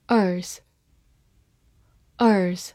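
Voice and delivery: ordinary delivery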